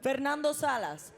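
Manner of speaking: usual speaking voice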